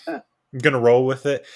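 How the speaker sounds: bright, loud, eager retold reply; upbeat go-along. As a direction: chirp it like you're game for anything. Retelling his own upbeat reply.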